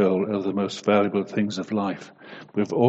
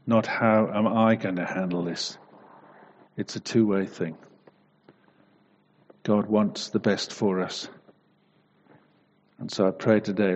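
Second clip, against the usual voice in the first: prayer. Real, not acted. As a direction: pray gently to the Lord. Closing prayer.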